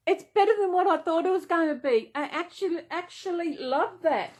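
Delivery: high, loud and fast with delight; thrilled gushing. Real, not acted. Showing viewers the blanket, rising real excitement. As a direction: rave about how beautiful it is.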